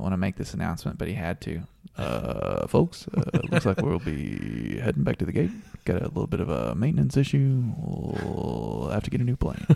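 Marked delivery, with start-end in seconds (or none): 2.0-9.6 pilot voice. Retold or imitated. Retold pilot announcement.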